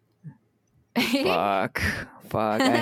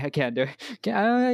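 ordinary talk, nothing marked

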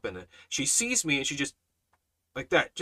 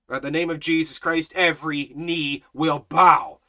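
loud, higher-pitched sermon delivery; full-force preaching; 5 semitones higher, 6 dB louder than usual. Real, not acted. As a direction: preach it hard, hammering each phrase.

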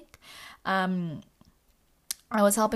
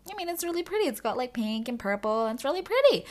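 higher, gushing about the sky; insistent delight; 7 semitones higher, faster than usual. Retold or imitated. Telling her friend the sky is pretty, gushing higher. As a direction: sell the sunset to a doubter.

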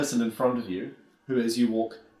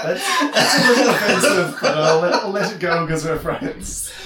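put-on old man voice; 5 semitones higher, 8 dB louder than usual. Old man answers rudely.

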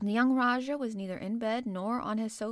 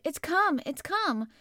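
excited; 7 semitones higher, 6 dB louder, slower than usual. Retold or imitated. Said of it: "when you ran in and said".

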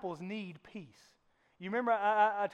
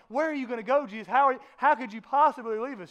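higher and louder, pleading; a young disciple upset at being left; John begging Jesus not to go, raised voice; distressed John; 4 semitones higher, 9 dB louder than usual. Retold or imitated. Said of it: "And John's like".

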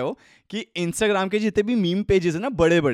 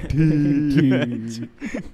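Amitabh meme voice; 3 semitones lower, much slower than usual. Retold or imitated. Mimicking Amitabh meme line.

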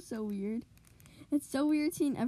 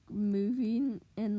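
usual speaking voice

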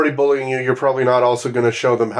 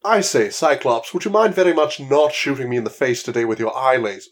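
put-on posh English accent; 3 semitones lower than usual. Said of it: "a very posh English accent".

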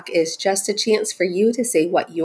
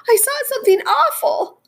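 tiny, very high mouse voice; frightened mouse. Acted. Mouse reporting to Frog, frightened high cry. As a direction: cry out as a scared little mouse. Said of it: "cried the mouse".

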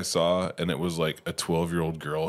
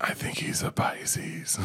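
whispering on the phone; 10 semitones higher, 6 dB quieter, slower than usual. Pretend. Continues whispered caller bit.

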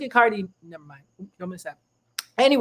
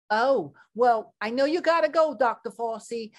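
sweet TV anchor closing the show; news reporter voice; 3 semitones higher, 7 dB quieter than usual. Acted. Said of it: "I'm Trudy Tirade".

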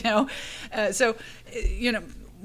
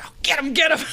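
higher, louder egging on; cheering on; 7 semitones higher, 7 dB louder than usual. Retold or imitated. The bystanders cheering her on, excited and louder. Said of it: "and then they were like".

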